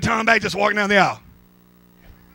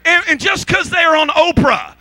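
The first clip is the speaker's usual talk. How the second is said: high-pitched, impassioned preacher shout; full-force preaching; 10 semitones higher than usual. Real, not acted. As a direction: drive every phrase home from the pulpit.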